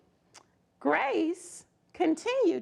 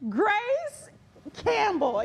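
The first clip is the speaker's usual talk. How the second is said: triumphant reveal; 5 semitones higher, slower than usual. Acted. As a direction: announce the winner in triumph. Triumphant announcement of winner.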